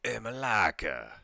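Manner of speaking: loud drawn-out taunting yell; teen hurling a loud Greek insult. Retold or imitated. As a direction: shout it at them from the moped. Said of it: "then they yell".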